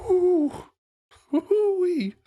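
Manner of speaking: sudden high whooping cry; excited whoop. Real, not acted. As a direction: let out a big amazed whoo-wee. Reacting to the song.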